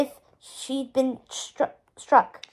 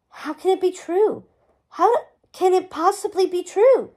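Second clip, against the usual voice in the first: higher-pitched, halting disbelief; disbelieving; 6 semitones higher, 3 dB louder than usual. A narrator's reading. Guinevere learning her parents live, stunned disbelief. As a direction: question it haltingly, overwhelmed.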